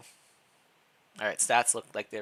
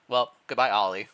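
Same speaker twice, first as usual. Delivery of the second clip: ordinary delivery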